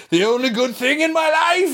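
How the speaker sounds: hammed-up despairing squeal; mock anguished wail. Mock-wailing retold outrage over Tommy.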